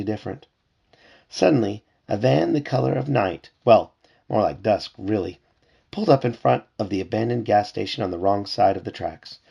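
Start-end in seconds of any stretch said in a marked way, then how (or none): none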